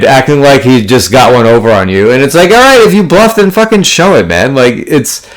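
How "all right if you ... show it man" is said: higher, pushy dare to show the cards; mock exasperated challenge. Poker player dares a showboating opponent, mock exasperated. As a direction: taunt him to flip his hand.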